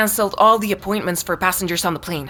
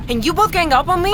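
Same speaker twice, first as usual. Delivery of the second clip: indignant accusation; 7 semitones higher than usual. Acted. Angry accusation in car.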